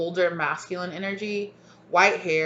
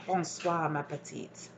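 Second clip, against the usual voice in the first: soft French; 2 semitones lower, 9 dB quieter, slower than usual. Retold or imitated. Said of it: "they're saying".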